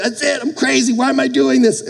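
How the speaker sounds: high-pitched replay of frustration; mock exasperation. Floundering swimmer talks to himself, mock exasperation. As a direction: act out giving up, voice pushed up high.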